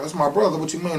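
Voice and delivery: high-pitched, indignant comeback; imitated indignant protest; retold protest. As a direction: act out a baffled objection, pitch jumping up. Protests to police about brother.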